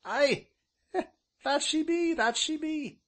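high, loud rustic ancient; high-pitched, chuckling village elder; delighted old man. A narrator's reading. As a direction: agree eagerly in a high old countryman's voice. Voices the ancient.

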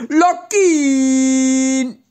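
Lockin wailed very slowly and high, like a tape played backwards; reversed speech. Shown as a demonstration. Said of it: "Daraus wird dann rückwärts".

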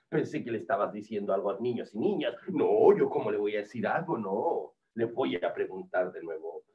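low, quick grumble from the hunter; low-voiced storybook hunter, suspicious; suspicious whale hunter. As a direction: play the hunter, well down in pitch and fast.

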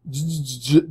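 frightened stammering; a terrified boy stammering his name to a stranger; terrified stutter. A narrator's reading. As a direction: stumble on the first sound in fear. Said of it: "Jack stuttered, terrified".

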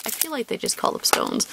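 ordinary talk, nothing marked

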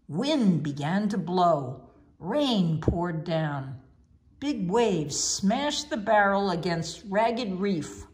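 ordinary delivery, nothing marked

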